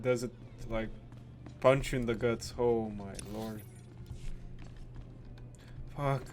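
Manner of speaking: ordinary talk, nothing marked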